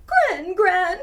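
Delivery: loud, high tearful cry of Gran Gran; weeping call. A narrator's reading. Child weeping as grandma leaves, loud crying call. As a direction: call out for her as he cries. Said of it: "he weeps".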